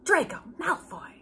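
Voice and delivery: breathy stage whisper of the name; hushed, teasing reveal; dramatic whisper. Acted.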